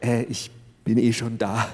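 hesitant, lightly voiced man; unsure neighbour. A narrator's reading. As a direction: shuffle in sheepishly. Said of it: "trat unsicher".

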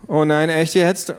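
put-on slow, exasperated teenage whine; an eye-rolling teen who wants out of the talk; teen eye-roll groan. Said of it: "rollte mit ihren Augen".

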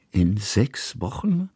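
slow, rising "Wochen?" in disbelief; surprised repeat. A narrator's reading. Old painter echoing the news, slow and surprised. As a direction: echo it back slowly, taken aback. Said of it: "wiederholte Kusmin".

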